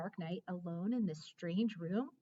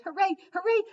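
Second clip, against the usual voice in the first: much higher, louder joyful yaps; squealing happy dog cheer; excited barking; 11 semitones higher, 12 dB louder, faster than usual. Acted. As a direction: yap it out overjoyed. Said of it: "I bark".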